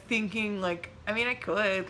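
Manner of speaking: ordinary talk, nothing marked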